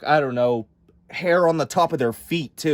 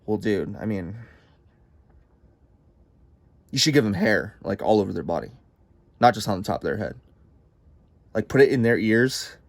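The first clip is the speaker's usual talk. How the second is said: low, soft slacker suggestion; laid-back Satan; 7 semitones lower, 3 dB quieter than usual. Acted. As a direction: pitch it lazily like a buddy. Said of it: "Satan's all like".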